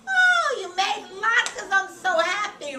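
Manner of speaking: very high, loud mock delight; gushing delight. Imagined parents thrilled by Rachel's latkes, exaggerated gushing delight. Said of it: "Instead of going".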